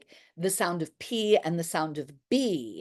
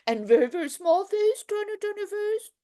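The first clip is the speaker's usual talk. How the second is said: much higher, squeezed small and light; tiny squeezed voice; 13 semitones higher than usual. Shown as a demonstration. Teacher leading face warm-up, high tiny squeezed voice. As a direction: shrink the voice with the face. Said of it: "make your face as small".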